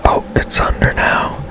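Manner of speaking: usual speaking voice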